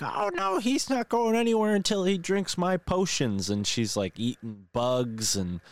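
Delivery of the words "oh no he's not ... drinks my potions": put-on much higher cackly old witch voice. Gnome witch claims the boy for her potions, much higher old crone voice. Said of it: "the woman's like".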